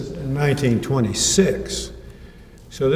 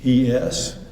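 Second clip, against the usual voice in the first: normal talking voice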